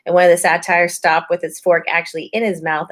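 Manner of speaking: plain reading voice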